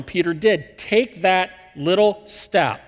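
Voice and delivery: ordinary talk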